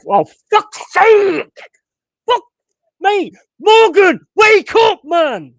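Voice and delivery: raging football fan; furious shout. Real, not acted. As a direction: scream at the player to wake up. Fan raging at his player.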